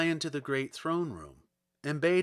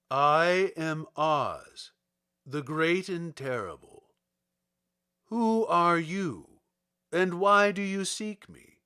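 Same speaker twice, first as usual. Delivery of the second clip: slow, loud, grand wizard voice; booming wizard; 3 semitones higher, 6 dB louder, slower than usual. Acted. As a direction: announce yourself grandly. Oz as ball of fire.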